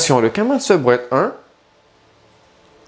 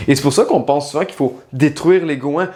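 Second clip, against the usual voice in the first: ordinary talk, nothing marked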